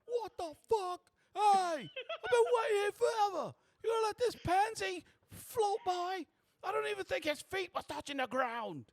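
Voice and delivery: very loud, high-pitched outraged yelling; indignant street-rabble holler; unhappy tramps. Acted. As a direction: rant at a queue-jumper at full shout. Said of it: "are not happy at all".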